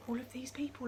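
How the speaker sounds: fast breathy whisper. Pretend. Reading to children, whispering in amazement. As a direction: whisper it in wonder.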